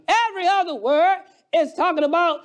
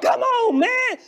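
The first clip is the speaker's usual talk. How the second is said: raised, ringing pulpit voice; fiery preaching; 4 semitones higher than usual. Real, not acted. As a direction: preach it out with fire. Preaching at full force.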